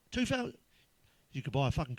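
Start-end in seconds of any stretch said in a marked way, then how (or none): 0.1-0.5 high-pitched disbelieving echo; incredulous. Acted. Comic reacting to friend's dog price, incredulous. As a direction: balk at the price, pitch shooting up.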